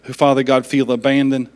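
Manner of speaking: prayer. Acted. Pastor leads closing prayer.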